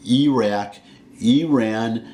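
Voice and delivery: Iraq, Iran as ee-RACK, ee-RAN; corrected pronunciation. Shown as a demonstration. Promising viewers from now on, Iraq, Iran with ee. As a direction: start Iraq and Iran with a clear ee. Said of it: "I will from now on say".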